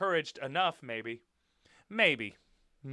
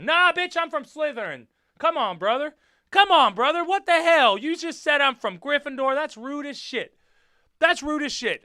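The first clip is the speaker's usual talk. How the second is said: loud, high-pitched playful indignation; mock outrage; 10 semitones higher, 8 dB louder than usual. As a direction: protest as if comically insulted. Mock quarrel over Gryffindor label.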